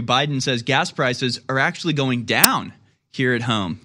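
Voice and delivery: ordinary talk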